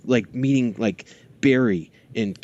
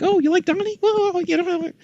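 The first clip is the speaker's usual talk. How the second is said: much higher, lightly put-on cartoon voice; Rob Paulson voice. Dad imitating Rob Paulson talking to his kid, higher voice. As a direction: chirp it like the voice actor greeting a kid. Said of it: "Rob Paulson is, is like".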